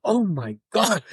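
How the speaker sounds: mock-astonished outburst, pitch lifted; retold amazement. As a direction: relive the stunned thrill. Retelling shock at a celebrity's letter.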